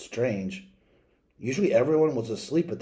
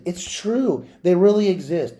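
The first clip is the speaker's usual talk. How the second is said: insisting; 6 semitones higher, 5 dB louder than usual. Acted. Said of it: "But I insisted".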